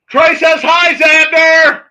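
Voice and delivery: loud, high-pitched shouted greeting; calling out. Real, not acted. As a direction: holler the greeting, dragging out the name.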